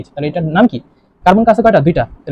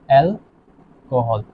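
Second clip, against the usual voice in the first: Alcohol very slowly, split into অ্যাল and kohl; drawn-out syllables; 5 semitones lower, 4 dB quieter, much slower than usual. Shown as a demonstration. Teacher spelling out the compound's name, drawn-out syllables. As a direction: stretch out alcohol with a gap inside, lower and trailing.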